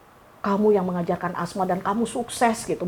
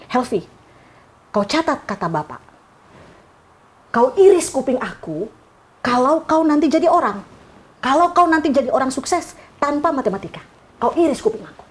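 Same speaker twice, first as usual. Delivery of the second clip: a teacher swearing a dare at a pupil; stern teacher threat; 6 semitones higher than usual. Retold or imitated. Angry teacher threatening student.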